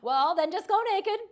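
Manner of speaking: loud, high-pitched mock sarcasm; hammed-up sarcastic outburst. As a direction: act a parent tossing out an exasperated, absurd suggestion. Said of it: "we're sarcastic".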